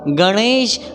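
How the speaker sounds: गणेश with a curled-tongue ṇ and "sh" ending; retroflex ण. Shown as a demonstration. Teacher models गणेश for learners, retroflex ṇ. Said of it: "मूर्धा में आपको जीभ को touch".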